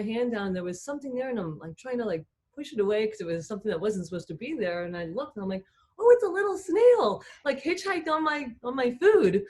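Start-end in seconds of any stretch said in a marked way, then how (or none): 6.0-9.4 high, loud burst of surprised delight; delighted discovery. Retold or imitated. Driver finds a little snail on her food, delighted. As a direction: re-enact a thrilled little find, pitched way up.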